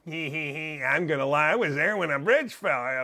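low, soft mock chuckling liar voice; mock him under your breath, low and sly; mocking liar impression.

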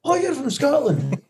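high, drawn-out mock amazement; impressed American. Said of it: "a completely different mindset".

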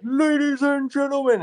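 high, booming stage announcer. Retold or imitated. Bandmate announcing the band on stage, high announcer voice. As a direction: announce the band like it's opening night. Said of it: "in an announcer voice".

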